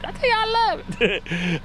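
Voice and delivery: goofy high-pitched take on the catchphrase; silly high voice. Pretend. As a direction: squeak it out playfully.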